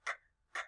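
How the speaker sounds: "K, k" as bare voiceless clicks; soft, separate "K, k" phonics sounds; beginning sound. Shown as a demonstration. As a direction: say only the sound "K, k", no vowel. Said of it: "What's the beginning sound of can?".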